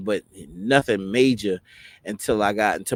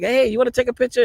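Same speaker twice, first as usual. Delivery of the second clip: high, loud, quick offer; happy onlookers imitated; 5 dB louder, faster than usual. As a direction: eagerly offer like delighted staff. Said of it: "they was all happy".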